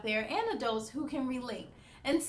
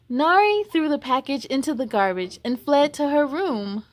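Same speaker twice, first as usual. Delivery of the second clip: lively, louder storybook voice; reading to children; 9 dB louder than usual. Acted. Host reading a picture book aloud to kids, lively. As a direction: read it brightly for young listeners. Narration with mom and Nari voices.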